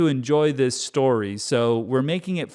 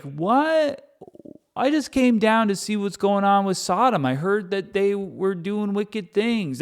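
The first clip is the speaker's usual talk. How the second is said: high, puzzled, easygoing; God dropping by Sodom, high and innocent; innocent casual God; 6 semitones higher than usual. Retold or imitated. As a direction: play the old laid-back God. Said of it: "I used to be like".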